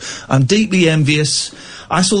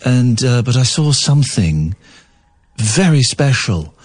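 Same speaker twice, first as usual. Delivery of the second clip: posh Epstein impression; 5 semitones lower than usual. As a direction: play Epstein recalling the [name], posh and measured. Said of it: "play Brian Epstein speaking".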